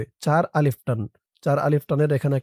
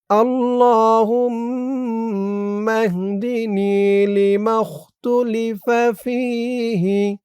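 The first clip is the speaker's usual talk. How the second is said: drawn-out, melodic dua chanting; slow Quranic-style recitation of a prayer; slow Arabic recitation; 5 semitones higher, much slower than usual. Shown as a demonstration. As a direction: recite each syllable long and lilting.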